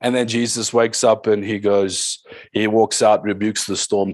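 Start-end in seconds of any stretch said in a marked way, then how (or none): none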